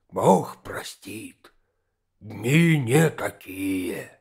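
slow holy-fool chanting; chewing and murmuring. A narrator's reading. Holy fool pleading for the huntsman, slow disjointed chanting. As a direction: drag out short phrases with pauses between. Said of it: "пережёвывая, приговаривал".

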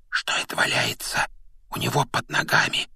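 hushed, breath-only question; soft conspiratorial whisper. A narrator's reading. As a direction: whisper it so the guard won't hear. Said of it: "прошептала Гермиона".

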